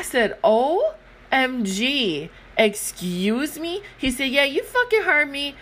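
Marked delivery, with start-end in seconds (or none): 0.4-3.8 offended drawn out. Retold or imitated. Offended gamer mom replying. 4.3-5.5 put-on bratty kid. Kid swearing at her.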